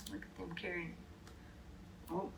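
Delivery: hushed aside whisper; soft secret whisper. Real, not acted. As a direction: whisper it to the listener. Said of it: "aside whisper".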